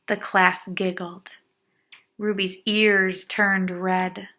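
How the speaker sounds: ordinary delivery, nothing marked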